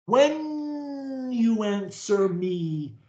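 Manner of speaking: "when you answer me" very slow, each word stretched; careful pronunciation. Shown as a demonstration. Coach instructs a stutterer how to answer, careful drawn-out pronunciation. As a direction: hold the sounds long in "when you answer me". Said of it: "pronunciation of every word".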